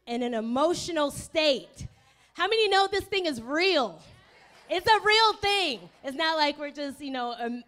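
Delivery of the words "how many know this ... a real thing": higher and louder, rallying; rally the room to agree; emphatic call-out. Real, not acted. Pastor asking the congregation to agree, emphatic.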